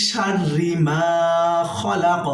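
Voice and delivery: slow, melodic chanted recitation; reciting Surah Falaq for students; Quran recitation. Shown as a demonstration. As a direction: stretch each word in chant. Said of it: "হরকতের উচ্চারণ তাড়াতাড়ি করতে হবে".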